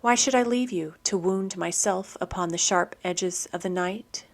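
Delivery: ordinary talk, nothing marked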